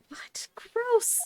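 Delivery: unvoiced whispered disgust; whispered gross. Real, not acted. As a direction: breathe it out, grossed out. Whispered reaction.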